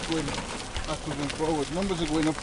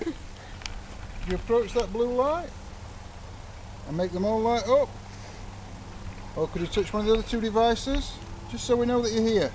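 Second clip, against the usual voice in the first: calling out to spirits; 7 semitones higher than usual. Acted. Ghost hunter calls out to spirits.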